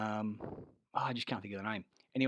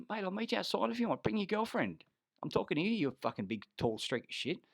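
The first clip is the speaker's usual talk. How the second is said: fight challenge; 11 semitones higher than usual. Retold or imitated.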